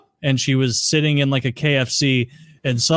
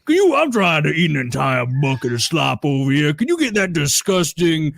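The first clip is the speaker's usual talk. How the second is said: Dan Soder; 3 semitones higher than usual. Retold or imitated. Customer demanding a girl be removed, mock Southern impression. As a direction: put on a big Southern complainer voice. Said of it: "Southern deep voice guy impression".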